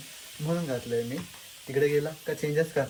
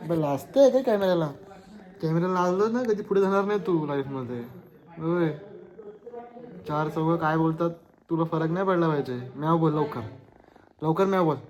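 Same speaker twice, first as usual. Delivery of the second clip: raised, higher voice pushing a shy pet; loud chiding; 3 semitones higher, 8 dB louder than usual. Real, not acted. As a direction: lecture it loudly and insistently. Scolding camera-shy pet into meowing. (मोठ्या आवाजात दटावणी)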